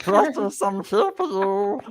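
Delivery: Gollum voice. Pretend. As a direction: coo it like Gollum to his treasure. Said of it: "can you do Gollum".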